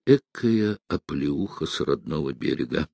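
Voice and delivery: low, softened musing; quiet, rueful thought to oneself; inner thought. A narrator's reading. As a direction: say it privately, under your voice. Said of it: "подумал Невельской".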